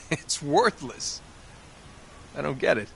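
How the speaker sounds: laughing scoff. Acted. Laughs at the oil lamp.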